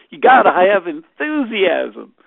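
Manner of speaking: big, booming Southern drawl; Southern accent. Retold or imitated. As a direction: belt it out slowly like a rousing Southerner. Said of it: "with his Southern accent".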